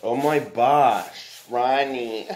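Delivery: drawn-out loud oh my gosh; excited exclamation. Real, not acted. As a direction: stretch it out, loud and dismayed. Reacting to frosting on shirt.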